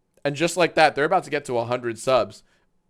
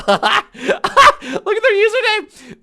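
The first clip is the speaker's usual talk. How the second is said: laughing hard, voice up high; 9 dB louder, slower than usual. Real, not acted. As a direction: burst out laughing at the name. Laughing at a chat username.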